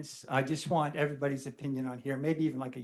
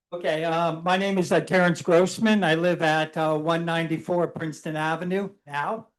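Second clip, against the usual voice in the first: louder so the room hears; speaking up louder; 3 semitones higher, 8 dB louder than usual. Real, not acted. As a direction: project your voice to the back of the room. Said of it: "Speak up louder".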